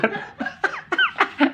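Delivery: a real laugh breaking out high; helpless high giggle; high burst of laughter. Real, not acted. Laughing at own story.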